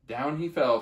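ordinary delivery

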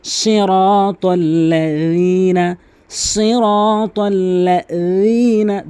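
সিরাতাল্লাযীনা slow, dhal not জ; tongue-tip dhal. Shown as a demonstration. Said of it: "জিহ্বার আগা থেকে".

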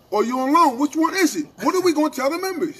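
high, loud, insistent questioning; retold confrontation, voice pushed up and loud; exasperated demand. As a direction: press hard for a straight answer. Acted demand for a straight answer.

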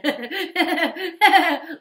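high, loud cackling laugh; eerie hyena laugh. Acted. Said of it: "laughing eerily".

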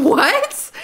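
high, loud shocked squeal; shocked friend. Acted. Friend hearing about a sudden proposal, high shocked squeal. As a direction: shriek it in disbelief.

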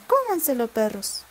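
grito agudo y fuerte a los perros; exclamación. Acted. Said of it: "exclamó". (la zorra grita a los perros que se coman el rabo)

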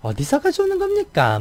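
very high, stiff Busan-tinged Seoul imitation; a Busan native straining to sound Seoul; Busan person faking Seoul speech. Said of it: "서울말 쓰려고 하는, 노력하는 부산 사람". (서울말 흉내 내는 높은 톤의 부산 억양)

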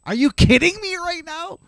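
high, squealing disbelief; squealed outrage at being suspended; outraged disbelief. Real, not acted. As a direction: cry out like you can't believe it. Outraged aside.

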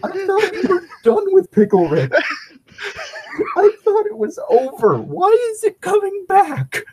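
high, laughing shout of despair; exasperated shout. Real, not acted. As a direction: cry out in mock anguish.

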